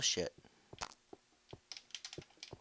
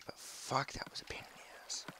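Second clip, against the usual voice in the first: breathless whisper under the breath; quiet relieved whisper; whispered curse; 3 semitones higher, 9 dB quieter than usual. Real, not acted. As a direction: whisper it, drained. Whispered curse after fight.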